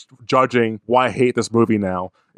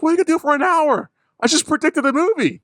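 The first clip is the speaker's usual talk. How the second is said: much higher, fast, exasperated yelling; mock yell at the TV. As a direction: shout at the screen in disbelief. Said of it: "I was just yelling at the T V".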